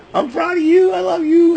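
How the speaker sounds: squeaky high imitation of a proud tender line; high mock voice. Bubba Ray voiced, tender quote.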